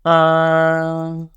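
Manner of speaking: level, held "Uh." at one pitch; flat first tone. Shown as a demonstration. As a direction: sustain "Uh." evenly, no rise or fall. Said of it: "let's all say the first tone".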